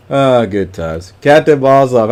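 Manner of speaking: ordinary talk, nothing marked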